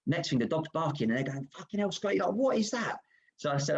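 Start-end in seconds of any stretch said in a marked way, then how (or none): 1.6-3.0 much higher, startled disbelief; retold shock from teammates hearing a dog bark; shocked outburst.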